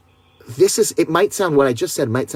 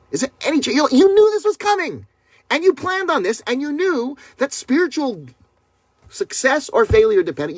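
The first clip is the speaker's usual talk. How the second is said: much higher, swept-up excited preaching; excited rise; 13 semitones higher than usual. Acted. Preacher urging listeners who knew it was coming, excited and high. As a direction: lift the voice high with rising conviction.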